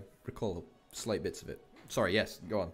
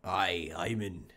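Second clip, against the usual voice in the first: slow put-on Scottish brogue; lightly done Scots voice; Scottish accent; 3 semitones lower, slower than usual. Scottish voice banter.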